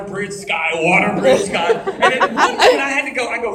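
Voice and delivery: ordinary delivery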